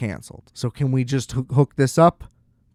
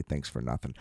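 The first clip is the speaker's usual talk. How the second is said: muttered aside; 12 semitones lower, 14 dB quieter than usual. Retold or imitated. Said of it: "I wasn't even mean enough to say".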